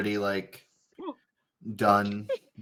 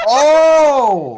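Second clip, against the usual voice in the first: loud high startled yelp; startled laugh; 11 dB louder than usual. Real, not acted. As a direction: jump at the noise. Startled by hiccup sound.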